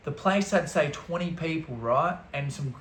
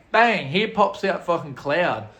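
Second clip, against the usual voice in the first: ordinary talk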